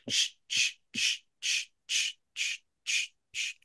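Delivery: even, whispered sh-sh-sh like hooves in dry leaves; steady deer footsteps. Shown as a demonstration. Hunter shows listeners how a walking deer sounds: steady whispered shuffles. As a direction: keep a steady, unhurried rhythm of soft shuffles. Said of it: "just a very consistent cadence".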